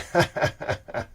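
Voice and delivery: quiet, voiceless chuckle; amused laugh. Real, not acted. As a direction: laugh softly at a funny result. Laughs at accent test result.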